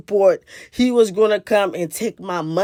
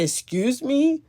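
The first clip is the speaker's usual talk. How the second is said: a higher, bewildered "Excuse me?" rising at the end; startled question; 7 semitones higher, slower than usual. Retold or imitated. Woman startled by a stranger's remark, bewildered. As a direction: ask "Excuse me?" as if you can't believe it. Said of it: "in an attitude where I was like".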